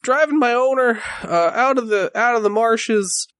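lightly voiced horse, hesitant and troubled; a touch of a frightened young horse; shaken talking horse. Horse tells of the attack.

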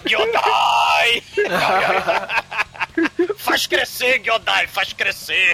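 shouted like a campy TV villain. Pretend. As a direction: bellow the summons, then slow down. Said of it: "imagina a Shilma chegando".

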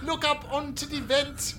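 high, slow mock German accent; Russian badly faking a German accent. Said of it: "Russian try to do a German accent".

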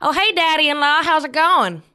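mocking shouted hello; yelled greeting. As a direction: call it out the window cheekily. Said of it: "yells out the window".

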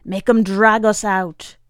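loud defiant rallying cry; old man urging resistance, loud; rallying shout. Acted. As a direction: egg the crowd on. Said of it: "cries Vic Lewis".

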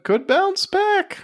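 high, slow sing-song hope; mock hopeful. Friend jokes the stock could rebound, mock hopeful. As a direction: draw it out like a hopeful investor.

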